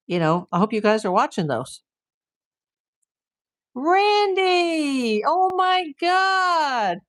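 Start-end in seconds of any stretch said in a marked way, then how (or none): 3.8-7.0 high, drawn-out delighted call; excited greeting. Real, not acted. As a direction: call out to him like a long-lost cousin. Greets cousin arriving in chat.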